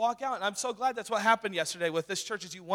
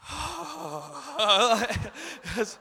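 fed-up sigh; 6 semitones higher than usual. Retold or imitated. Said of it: "my band teacher would just be like".

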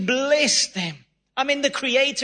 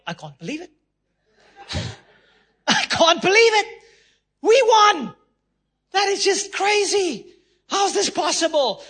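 much higher and louder amazed cheering; mock disbelieving joy; 9 semitones higher, 4 dB louder than usual. South African fans after the Rugby World Cup win, mock elation. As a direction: shout it like you just won the Cup. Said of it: "we're all like".

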